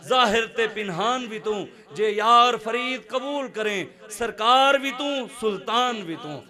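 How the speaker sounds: measured, chant-like refrain building in pitch and force; devotional poem recitation. Real, not acted. Preacher reciting a local poet's devotion to the Prophet, fervent recitation. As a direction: declaim each phrase with a drawn-out falling cadence.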